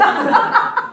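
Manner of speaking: high unvoiced burst of laughter; high breathy laugh. Real, not acted. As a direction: laugh out at own joke. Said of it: "laugh after joke". (높게 터지는 웃음)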